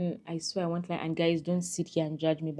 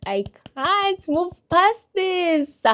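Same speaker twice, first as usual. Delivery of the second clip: high, loud, drawn-out wail; dramatic despair; 13 semitones higher, 7 dB louder, much slower than usual. Acted. Telling viewers how his accent made her feel, dramatically despairing. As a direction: wail it slowly, theatrically overwhelmed.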